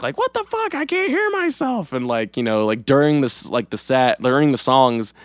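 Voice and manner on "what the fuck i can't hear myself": very high-pitched strained mock yell, held back in volume. Fan imitating the guitarist's onstage tantrum, mock yelling. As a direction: imitate a musician screeching into the mic. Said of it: "he was even, like, yelling on the mic".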